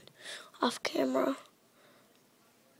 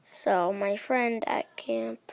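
usual speaking voice

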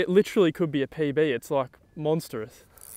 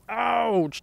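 sharp pained yelp; 7 semitones higher than usual. Real, not acted. Fish bites his hand.

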